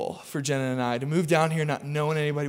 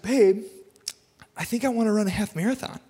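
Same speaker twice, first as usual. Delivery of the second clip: mock wife voice; 4 semitones higher than usual. Wife pitching a half marathon to husband on the couch, mock wife voice. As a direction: imitate your wife with a slightly silly, higher delivery. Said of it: "I don't know why I talk like that. My wife doesn't talk like that".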